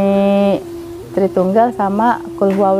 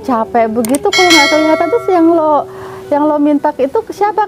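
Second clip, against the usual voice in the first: higher-pitched, incredulous questioning; retold challenge; 6 semitones higher than usual. Questioning her friend about whom she prays to, incredulously. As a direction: re-enact a pressing, sceptical challenge.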